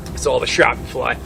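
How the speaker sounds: ordinary delivery, nothing marked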